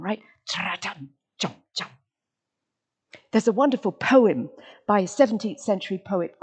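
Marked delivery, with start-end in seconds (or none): 0.5-1.9 imitated drum. Shown as a demonstration. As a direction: tap out a quiet drum with the voice. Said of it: "It's a fabulous drum".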